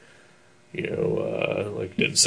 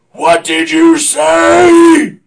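slow, much higher, much louder angry yell; furious demand; 12 dB louder, much slower than usual. Retold or imitated. Angry dad turning on a heckler in the theater, loud slow yell. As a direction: drag each word out like a furious dad. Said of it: "just starts screaming at me".

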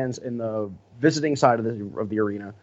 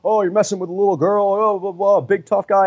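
higher-pitched, half-hearted imitation of an angry fan; mock angry drunk fan; 8 semitones higher than usual. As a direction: sneer it like a drunk guy picking a fight. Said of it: "he just got really angry".

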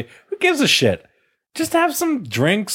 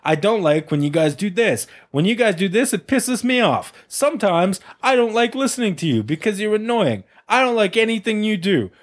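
higher-pitched mock caller with an almost Italian twang; put-on caller voice; 5 semitones higher than usual. Host acting out a listener's voicemail complaint, put-on caller voice. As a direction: voice an annoyed caller as a hammed-up character. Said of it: "What is that voice you had for that".

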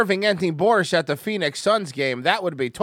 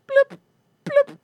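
very high tiny blip sound effects; squeaky blip noise. Pretend. Streamer voices a silly sound effect, squeaky blips. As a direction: make a cartoon blip noise.

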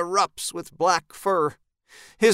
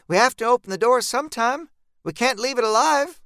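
higher, quicker character voice; insistent reasoning in a raised pitch; Jean persuading; 8 semitones higher than usual. Acted. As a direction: press the point, pitched up.

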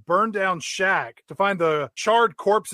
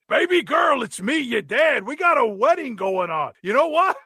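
princess's hammy dad; 2 semitones higher than usual. Acted. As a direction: gush like an overemotional dad at a wedding. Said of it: "Turns out it's the princess's dad".

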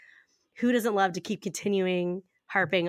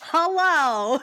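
sing-song hello; 8 semitones higher, 4 dB louder than usual. Acted. Sing-song show greeting.

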